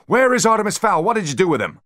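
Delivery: very high, loud demand; screaming. A narrator's reading. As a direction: scream at the bodyguards.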